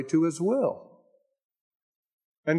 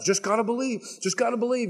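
higher, chanting mock pep talk; mock self-psyching; 7 semitones higher, faster than usual. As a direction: parody someone pumping themselves up. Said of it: "They're psyching themselves up".

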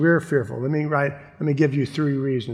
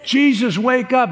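urgent call; 9 semitones higher, 4 dB louder than usual. Pretend. Disciples waking Jesus, imagined.

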